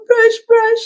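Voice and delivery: high whiny mock toddler cry; imitating crying toddler. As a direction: whimper it like a small child wanting a toothbrush. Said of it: "while crying".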